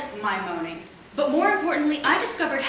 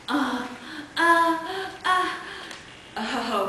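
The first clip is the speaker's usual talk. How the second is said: short separate moans, each on a new pitch; practice moaning; 4 semitones higher, 4 dB quieter, much slower than usual. Acted. Woman recalls practicing into a tape recorder, mock moaning. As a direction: fake moans in varied pitches. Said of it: "moaning in various keys, various pitches".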